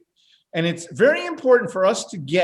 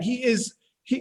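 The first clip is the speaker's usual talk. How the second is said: ordinary talk, nothing marked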